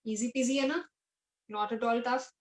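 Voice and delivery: ordinary talk, nothing marked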